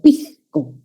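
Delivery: pisco with the S as a soft J, pixko. Shown as a demonstration. Teacher demonstrating the coastal aspirated S. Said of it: "debilitamiento de ese como una J suave".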